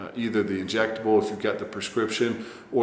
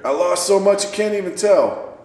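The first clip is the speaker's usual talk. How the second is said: laughing; 9 semitones higher, 5 dB louder than usual. Real, not acted. Laughing at scale error.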